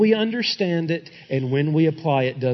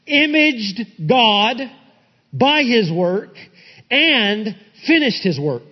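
high, emphatic pulpit delivery; forceful preaching; 6 semitones higher than usual. Real, not acted. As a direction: proclaim it to the congregation, hitting key words.

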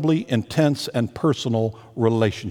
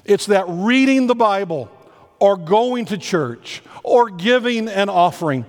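raised, insistent pulpit delivery; preaching; 6 semitones higher than usual. Acted. New senior pastor urges everyone in his first sermon, fervent. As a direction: press the point home to the whole church.

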